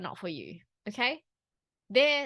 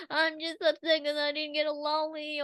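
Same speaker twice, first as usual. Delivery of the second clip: put-on higher, whiny little-kid voice; child's voice; 6 semitones higher than usual. Imitating an upset child asking for a lolly, whiny kid voice. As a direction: play a sulky child explaining why. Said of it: "It's like".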